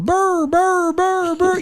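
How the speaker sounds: high yappy dog barks; squeaky mock dog barking; imitating dogs. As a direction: bark like little dogs.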